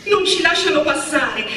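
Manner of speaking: exasperated. A narrator's reading. Dina answers Miretta in the crowd, exasperated. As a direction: throw it out in frustration. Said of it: "rispose Dina esasperata".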